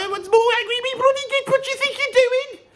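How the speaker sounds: Brummie anger climbing into falsetto; angry high Brummie. Shown as a demonstration. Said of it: "we get really high".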